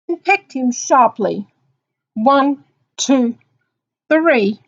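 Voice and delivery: loud, slow, separated beats; emphatic counting. A narrator's reading. Reader acting the bird pecking Mister Magee, loud emphatic count. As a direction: punch out each count like a peck. Acting out bird's sharp pecks.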